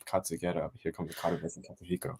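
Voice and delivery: low, fast trash-talk growl; mock Okada promo. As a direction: ham up a wrestler's promo threat. Imitating Okada's fired-up promo.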